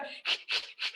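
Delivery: rhythmic hissy scrubbing sound; toothbrushing noise. Acted. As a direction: imitate brushing teeth with the mouth. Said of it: "and you go".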